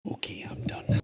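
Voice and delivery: breathy whisper, repeating the character's line; whispered echo. Retold or imitated. Host whispers the character's line back after the clip, in a hushed echo. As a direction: whisper it back as if you were him.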